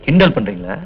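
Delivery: low, menacing scolding; deep, domineering lecture; stern threat. Acted. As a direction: warn them off with a growl. Man scolds women threateningly.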